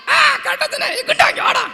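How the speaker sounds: very high puppet-character voice; டிங்கன். Pretend. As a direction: call out to the crowd in a squeaky high voice. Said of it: "டிங்கன் வந்து ஒரு கதை". (மிக உயர்ந்த பொம்மைக் குரலில்)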